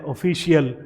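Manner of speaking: ऑफिशियल with a full "shi-yal" ending; wrong pronunciation. Shown as a demonstration. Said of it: "ऑफिशियल जो है वो गलत है".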